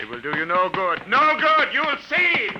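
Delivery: very high and loud, unhinged shrieking threat; maniacal shriek. Acted. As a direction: screech it like a madman. Alien's parting threat as he flees.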